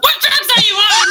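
laughing mock shout of the paramedic's question; yelled at. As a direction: bark it like a paramedic, cracking up. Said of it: "suddenly we were being yelled at".

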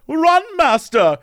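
high, slow urgent butler warning, lightly acted; a loyal servant urging his master to flee. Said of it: "the butler's like".

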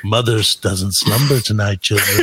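put-on slow, low eerie warning to the children; hammed-up creepy parent telling the kids the mother is awake; spooky storyteller. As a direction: drag it out like a ghost story.

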